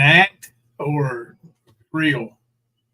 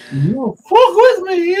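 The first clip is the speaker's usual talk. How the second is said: much higher, slow, squeaky threat; mock high-pitched threat; 8 dB louder, slower than usual. Imitating the contestant's high-pitched threat for a friend. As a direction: squeak it way up while imitating her. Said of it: "I could hit that high pitch".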